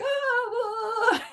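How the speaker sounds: high, put-on "Ah" of alarm; mock panic cry. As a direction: mimic spiralling into worry.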